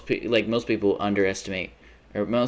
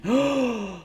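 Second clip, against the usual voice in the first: high, shocked gasp; sharp gasp; 14 semitones higher than usual. Real, not acted. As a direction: gasp in horror. In-game disaster.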